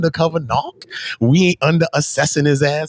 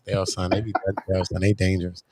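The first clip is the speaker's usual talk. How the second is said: hushed warning; 7 semitones lower, 8 dB quieter than usual. Acted. Warning they try to clip us.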